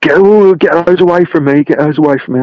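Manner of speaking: high, loud panicked yelp; panicked shriek. Retold or imitated. Friend with nut allergy shrieks in fear. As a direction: recoil in mock terror.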